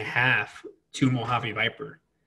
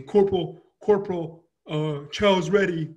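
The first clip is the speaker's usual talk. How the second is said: imitated wake-up call; 5 semitones higher than usual. Marine waking his passed-out corporal for chow, higher-pitched calling. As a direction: lightly voice someone rousing a sleeper, pitched up.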